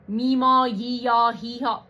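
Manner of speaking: Meemaw, yee-yaw, hee-haw tossed off with a sneer, Meemaw loud with a falling tune; mocking hillbilly names. Vlogger sneering at hillbilly names his viewers use, mock drawl. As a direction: scoff at the silly names. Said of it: "That sounds so dumb and stupid".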